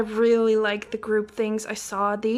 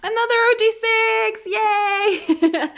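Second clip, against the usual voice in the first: much higher, slow, loud cheer; excited cheer; 11 semitones higher, 7 dB louder, slower than usual. Real, not acted. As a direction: cheer it out, delighted. Cheers at another group card.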